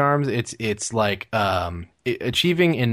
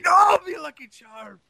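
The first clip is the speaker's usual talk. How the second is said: high, loud hammed-up climactic cry; mock climax, vigorous; 5 dB louder than usual. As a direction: cry out as if peaking, in jest. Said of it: "with more vigor".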